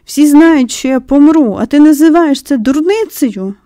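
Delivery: put-on loud, high indignant boy; outraged shout. A narrator's reading. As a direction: hurl it back, offended and loud.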